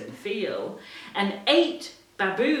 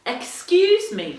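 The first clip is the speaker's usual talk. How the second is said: cricket's polite cough; 6 dB louder than usual. Acted. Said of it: "coughed a cricket".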